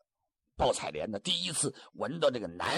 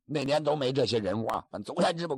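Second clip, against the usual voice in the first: ordinary delivery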